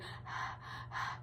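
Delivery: creepy excited panting, almost voiceless; hushed, eager huffing; excited breathing. Retold or imitated. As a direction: imitate her quiet, excited breaths. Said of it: "really, like, excited".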